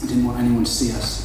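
normal talking voice

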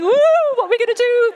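high, loud squeal of panic; mock panic. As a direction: flap about like a panicking hen. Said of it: "so we're all panicking".

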